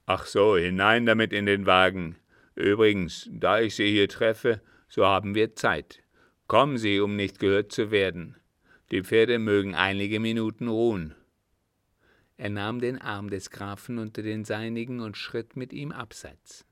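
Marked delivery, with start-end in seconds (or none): 0.1-11.1 loud, firm captain; commanding captain. A narrator's reading. Captain directs the count and the luggage, commanding. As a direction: take charge in a louder voice. Captain gives brisk instructions.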